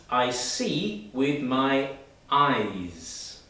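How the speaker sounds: very slow, ending "eyes" on a buzzing z. Shown as a demonstration. Teacher models "eyes" for students, slowly. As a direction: draw out "eyes" and buzz the z. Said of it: "has the z sound".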